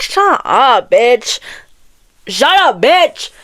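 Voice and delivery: high-pitched exasperated yell, played up; mock angry outburst. Annoyed reader telling off a book character, mock angry outburst. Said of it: "I got mad".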